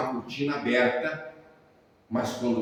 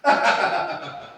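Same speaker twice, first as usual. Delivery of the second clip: short amused laugh; a retold chuckle of surprise; pastor's laugh; 5 semitones higher, 4 dB louder than usual. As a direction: laugh out in disbelief. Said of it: "ele começou a rir".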